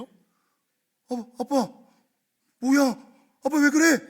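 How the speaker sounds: put-on high, loud, distressed child's pleading; a hurt boy who can't believe his dad pushed him; loud, anguished child voice; upset child. Child pushed away by father.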